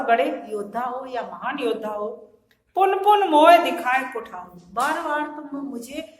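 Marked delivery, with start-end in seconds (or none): 2.8-4.6 loud declaimed verse; verse recitation. Shown as a demonstration. Teacher quoting Lakshman on the axe, loud recitation. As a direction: recite it loudly to the class.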